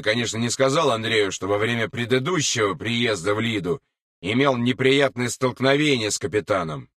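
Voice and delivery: ordinary delivery, nothing marked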